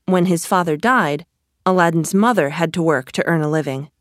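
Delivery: ordinary delivery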